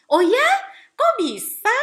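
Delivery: high, loud imitation of an amazed kid; surprised child's question. Children reacting to parents' making up, mock amazement. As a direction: pitch it up, astonished and curious.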